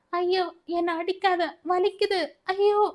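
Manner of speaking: put-on a girl shrieking as the belt hits her; screaming in pain. A narrator's reading. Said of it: "அவள் அலற".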